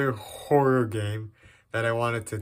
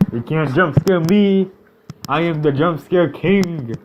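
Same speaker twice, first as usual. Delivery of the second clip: cocky boast; 5 semitones higher, 4 dB louder than usual. Real, not acted. Bragging to the game.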